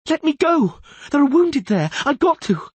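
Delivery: high, loud shrieking plea; hysterical scream. Acted. Young medical student screaming to be let go, hysterical. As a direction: shriek to get free and help. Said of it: "was screaming hysterically".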